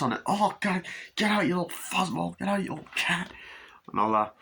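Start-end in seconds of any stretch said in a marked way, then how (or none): 0.3-3.3 high-pitched shooing of a pest; mock exasperation. Man chasing the cat out, high mock exasperated voice. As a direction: act out a flustered telling-off.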